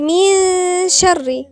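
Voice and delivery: very slow, loud recitation of من شر; hidden-n ghunnah. Shown as a demonstration. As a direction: stretch the ن of من into a nasal hum leading into "sh". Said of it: "فنقول".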